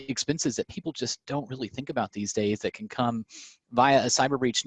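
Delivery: ordinary talk, nothing marked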